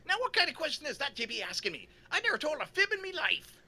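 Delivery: put-on indignant Irish accent. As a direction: bristle in a high Irish voice. O'Leary indignant.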